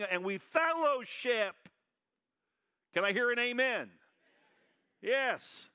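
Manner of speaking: stirring call to the congregation; preaching at full force. Acted. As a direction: lift the congregation to an amen.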